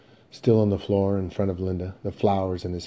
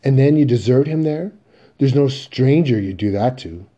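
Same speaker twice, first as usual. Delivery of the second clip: loud, higher-pitched reproach; indignant scolding; 6 semitones higher, 10 dB louder than usual. Acted. Mother to her son about deserting his father, indignant. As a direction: scold them in raised-voice disbelief.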